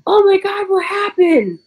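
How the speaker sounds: very high panicked mom's shriek; mother coming home from work, panicking. Retold or imitated. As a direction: play the mother bursting in alarmed. Mother voiced finding blood.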